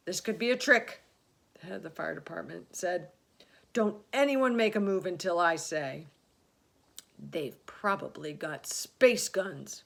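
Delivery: wary fire chief. A narrator's reading.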